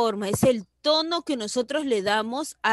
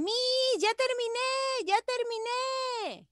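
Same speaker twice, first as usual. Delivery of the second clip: imitating joyful pupils; 13 semitones higher than usual. Teacher imitating pupils who finished their work, joyful high call. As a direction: sing out proudly like an eager pupil. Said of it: "lo están haciendo con una alegría".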